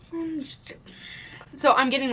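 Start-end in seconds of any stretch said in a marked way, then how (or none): 0.1-0.7 higher, hushed fuming mutter; pissed. Retold or imitated. Shopper getting super mad in the checkout line, pissed-off hushed mutter. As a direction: swear very softly under your breath. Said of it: "that's how you know I'm pissed".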